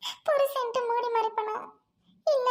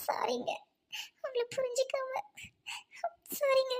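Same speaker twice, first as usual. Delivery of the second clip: whispered sobbing apology; 3 dB quieter than usual. Acted. Wife apologizes to husband weeping.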